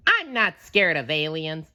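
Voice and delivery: a hint of a cheerful cartoon sponge; SpongeBob voice. A narrator's reading. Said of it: "SpongeBob told himself".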